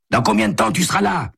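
high, quick character voice; phone caller. A narrator's reading. Caller asks Santo when he will arrive, high and brisk. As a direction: pitch the caller well up and keep it brisk.

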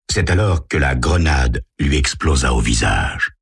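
usual speaking voice

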